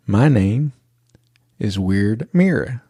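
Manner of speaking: imitated child spacing out each part with long pauses; slowed right down. Little girl obeying her dad, slow and deliberate. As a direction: say the name slowly and deliberately. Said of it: "slow it down a little bit so people can understand".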